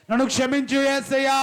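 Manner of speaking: high, loud crying-out for mercy; shouted plea. Acted. Preacher at the climax of the prayer, shouting for forgiveness. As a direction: shout it as the last desperate plea.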